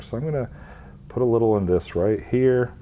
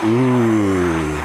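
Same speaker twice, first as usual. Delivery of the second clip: drawn-out admiring ooh; an impressed friend's loud ooh; 6 dB louder than usual. Retold or imitated. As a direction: play the friend wowed by the work. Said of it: "make all your friends and family go".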